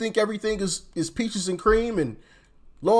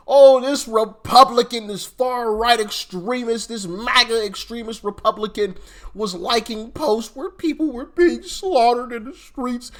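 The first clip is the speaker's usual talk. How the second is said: slow, higher and louder, hammed-up news-anchor outrage; mock outrage; 5 semitones higher, 3 dB louder than usual. Mocking the networks, he voices their hammed-up outraged reporting. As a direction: sneer it like a pundit clutching pearls.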